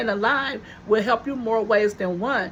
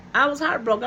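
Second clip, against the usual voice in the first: normal talking voice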